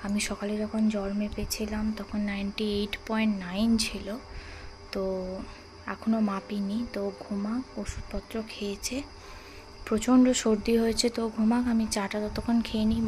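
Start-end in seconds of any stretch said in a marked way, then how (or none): 0.1-9.0 whisper. Real, not acted. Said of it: "আমি এত আস্তে কথা বলছি". (ঘুমন্ত মেয়ের পাশে ফিসফিস করে)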